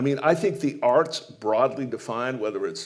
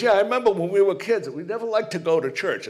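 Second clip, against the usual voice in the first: higher, louder, hearty bluff guy; eager jock voice; 7 semitones higher, 4 dB louder, faster than usual. Retold or imitated. Coach telling the nun he knows her order, hearty. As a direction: play the big friendly coach, pitched high.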